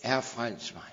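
quiet plea. Retold or imitated. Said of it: "han forventer ... end at".

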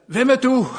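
high, loud retold shout; calling out. Preacher re-enacts hailing the departing stranger, calling out. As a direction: call out the question across a distance. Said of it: "råbte jeg".

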